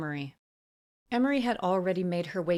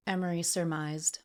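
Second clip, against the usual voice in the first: plain reading voice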